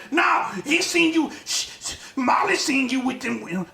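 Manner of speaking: a slightly botched go at the voice; imitated scolding voice. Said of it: "gonna do the voice right".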